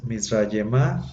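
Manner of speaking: Mizrayemá slow, ending in a plain a, no final sound; teacher shows the silent ending of Mizrayemá; Hebrew ending a. Shown as a demonstration. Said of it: "no se pronuncia la G final".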